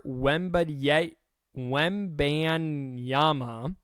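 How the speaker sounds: Wembanyama sounded out very slowly; a careful, halting attempt at a hard name; really slow. Real, not acted. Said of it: "have to say that really slowly".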